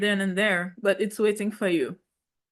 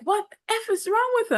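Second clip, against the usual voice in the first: high shocked mom voice, pitched way up; an indignant mother's yelp; mom's outrage. Retold or imitated. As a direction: play the outraged mom, squeaking it out.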